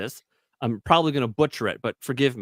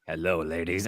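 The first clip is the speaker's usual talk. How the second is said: sultry voice; 9 semitones lower, 6 dB quieter than usual. Retold or imitated. Imitating Chris greeting female fans, mock sultry and low. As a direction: lower and softer, a mock seductive croon. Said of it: "it's probably that sultry voice".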